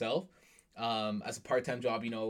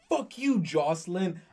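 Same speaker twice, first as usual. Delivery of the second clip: higher and louder, a hammed-up cheeky snap at mom; mock defiant yell; 6 semitones higher, 6 dB louder, slower than usual. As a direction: play the brat who dares to curse at mom, half laughing. Imagining cursing at own mom.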